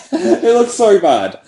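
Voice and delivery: laughing. Real, not acted. Laughing at own drawing.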